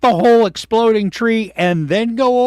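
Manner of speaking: high, loud frustrated ranting; half-mock outrage at the viewer; exasperated yell. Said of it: "And I'm like".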